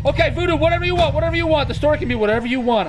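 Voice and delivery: high-pitched frantic pleading, rushed; panicked placating. Real, not acted. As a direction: blurt it out to appease the threat. Panicked placating after a scare.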